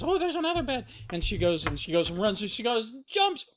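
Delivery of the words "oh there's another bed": put-on high-pitched little girl character; Goldilocks. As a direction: pipe up high as the storybook girl.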